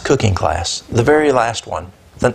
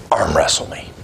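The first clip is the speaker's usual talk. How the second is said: low, quieter, heavy voice of a big dock worker; big guy's challenge; 9 semitones lower, 4 dB quieter than usual. Retold or imitated. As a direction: drop low like a huge strongman daring you.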